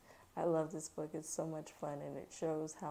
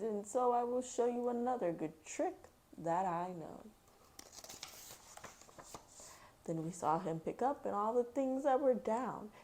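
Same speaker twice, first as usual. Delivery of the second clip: put-on lively, louder read-aloud voice for kids; story reading aloud; 5 semitones higher, 4 dB louder than usual. A narrator's reading. Mom reading Dr. Seuss to her kids, lively read-aloud. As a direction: read the rhyme to a child with bounce.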